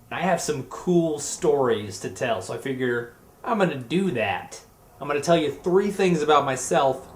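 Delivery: usual speaking voice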